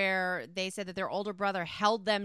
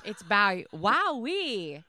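announcer voice; 6 dB louder, much slower than usual. Acted. Drawn-out brand name.

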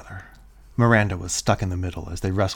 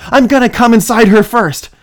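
loud high demand; 17 dB louder than usual. Acted. Caitlin keeps demanding.

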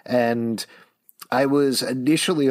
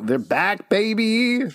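excited hype; 10 semitones higher, slower than usual. Acted. Hype exclamation.

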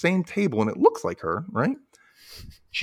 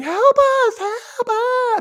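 high, louder cries for help; cartoon scream; 5 dB louder, much slower than usual. Cereal pieces drowning in milk, screaming high. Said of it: "they're screaming".

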